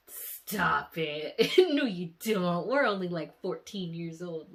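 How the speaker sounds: loud, scoffing brush-off; dismissive retort. Retold or imitated. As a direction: wave him off like a teen. Brushing off his love confession.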